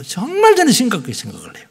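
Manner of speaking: ordinary delivery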